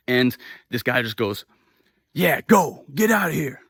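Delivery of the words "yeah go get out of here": loud, higher, aggressive send-off; mock the man yelling them away; imitated dismissive shout. Man as the couple leaves, imitated dismissive shout.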